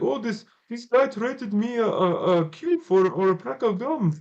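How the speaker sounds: high-pitched imitation of a Russian kid's accent; a Russian kid bragging about his trade, in accent. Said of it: "he was a Russian kid".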